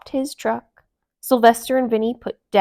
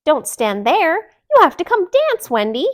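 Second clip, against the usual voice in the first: loud, high bossy call; loud, high bossy demand; 8 semitones higher, 6 dB louder than usual. Acted. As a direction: insist she come dance. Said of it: "Delilah demanded".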